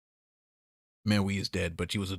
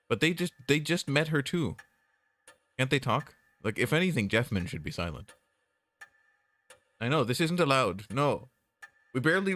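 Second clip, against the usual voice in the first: higher and louder, aggrieved; upset complaining; 7 semitones higher, 3 dB louder than usual. Real, not acted. Upset that the others stay silent, complaining loudly. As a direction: vent that it's unfair. Upset nobody talks about Maui.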